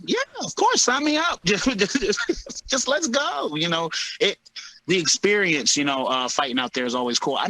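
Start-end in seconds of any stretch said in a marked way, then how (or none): 0.1-3.6 high-pitched, game-for-anything agreement; eager yes. Retold or imitated. Fighter acting out saying yes to the promotion, eager. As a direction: jump at it in a high voice. Eager answer to a wild offer.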